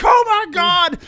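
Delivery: high, loud mock gasp of the sister; shocked sister. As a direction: shriek it like a horrified mom. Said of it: "Heather's like".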